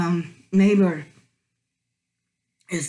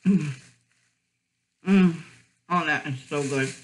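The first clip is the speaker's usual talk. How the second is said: talking while biting food; muffled by food at lips; mouth full; 2 semitones lower than usual. Real, not acted. Seen 1: Wrapped food held pressed at the mouth while she speaks.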